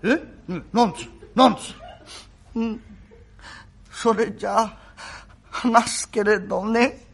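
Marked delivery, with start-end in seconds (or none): 2.6-7.0 very slow, halting, bashful sick voice; hesitate, too shy to say it; shy invalid. Acted. The sick young master, too shy to confess to Kuma, slow and halting.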